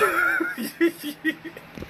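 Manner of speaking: wheezy high laugh after choking; high breathy laugh. Real, not acted. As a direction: laugh through a sore throat.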